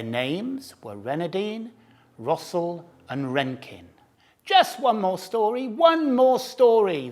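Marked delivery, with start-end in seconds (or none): 4.5-7.1 high, loud pleading for one more story; demanding cubs. A narrator's reading. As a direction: beg like eager little cubs. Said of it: "they demanded".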